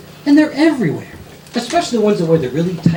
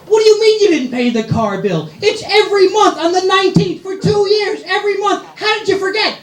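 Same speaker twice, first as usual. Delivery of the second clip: exasperated yelling; 11 semitones higher than usual. Retold or imitated. Husband yelling at wife about the unpaid car bill, high and exasperated. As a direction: berate her over the car bill.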